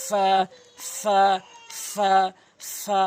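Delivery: ফা with lip-to-teeth f, vowel held; one-alif stretch. Shown as a demonstration. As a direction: draw out ফা for one count. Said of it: "এক আলিফ টেনে পড়বেন".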